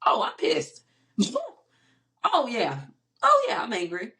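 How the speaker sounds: high, amused realization of anger; dawning anger. Retold or imitated. Realizing buried anger at uncle, half-amused admission. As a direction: lift it high, admitting it to yourself. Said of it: "as I sat and thought about it".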